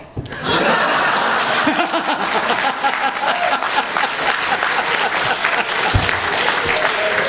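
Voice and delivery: high breathy laugh; real laugh at the memory; a genuine high laugh. Real, not acted. Laughing at the worker's reply.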